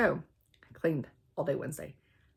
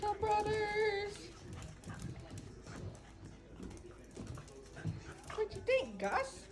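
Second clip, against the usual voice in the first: pet talk; 11 dB quieter, slower than usual. Real, not acted. Cooing to her dogs.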